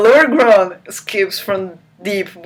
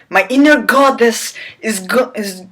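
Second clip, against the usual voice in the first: rising to a high loud "what the hell"; mocking disbelief; 7 semitones higher, 5 dB louder than usual. Real, not acted. Reader mocking the inner goddess, building high and loud. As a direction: build to an exasperated outburst.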